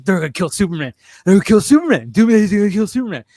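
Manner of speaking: thrilled fan in the theater, voice climbing; excited rant. Retold or imitated. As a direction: tumble over the words in delight. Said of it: "I immediately got excited".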